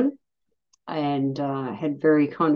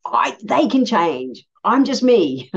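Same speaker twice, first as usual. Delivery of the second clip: slow, high, self-satisfied I'm just me; proud and stubborn, drawn out slow; cocky self-talk; 6 semitones higher, 4 dB louder, slower than usual. Retold or imitated. Past self to herself, smugly sure of herself. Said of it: "just thought".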